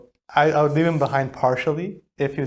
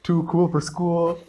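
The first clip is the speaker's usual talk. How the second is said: laughing; 4 semitones higher, slower than usual. Real, not acted. Laughing about hoodie teen brother.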